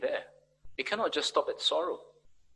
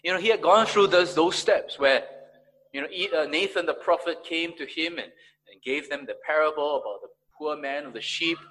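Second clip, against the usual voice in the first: usual speaking voice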